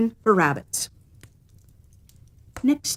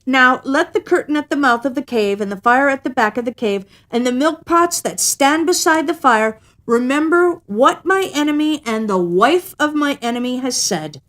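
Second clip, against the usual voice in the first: higher, louder, declaiming; solemn cat vow; 6 semitones higher, 4 dB louder than usual. A narrator's reading. Cat swearing the cave remember the bargain. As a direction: proclaim it like a curse. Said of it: "the cat arched his back and said".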